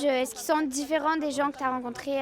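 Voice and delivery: normal talking voice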